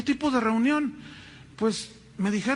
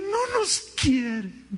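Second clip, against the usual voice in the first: mock whine; 8 semitones higher, slower than usual. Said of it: "Siempre le van a decir".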